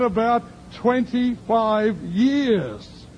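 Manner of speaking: drawn out, proud church lady; voicing the woman. The woman telling the preacher her church years, high and slow.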